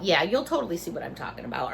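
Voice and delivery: low, soft, fast muttered aside; sarcastic aside. Real, not acted. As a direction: throw it away under your breath. Quiet quick sarcastic repeat.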